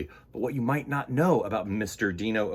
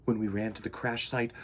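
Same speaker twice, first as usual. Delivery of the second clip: usual speaking voice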